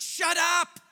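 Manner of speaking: put-on Paul rounds on the girl and yells; angry shout. Paul silencing the spirit-filled girl, shouted. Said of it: "he just turns around and is like".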